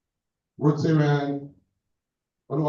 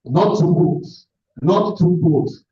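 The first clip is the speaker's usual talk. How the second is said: loud, higher, drawn-out warning; loud correction; 5 semitones higher, 8 dB louder, slower than usual. Real, not acted. Host to Rotarian stepping too close, loud correction. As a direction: call out a firm, slowed warning.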